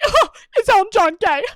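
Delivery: high-pitched mock gasp of a question; teasing mimicry. Boyfriend's family teasing her, high mock-naive mimicry.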